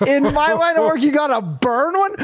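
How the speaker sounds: high, amazed disbelief; can't believe the line made it in; amused disbelief at a movie line; incredulous. Real, not acted. Incredulous rant about God line.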